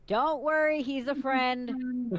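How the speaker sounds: loud, slow reassurance; calling out. Acted. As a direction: call out slowly to calm him. Calling out to shocked man.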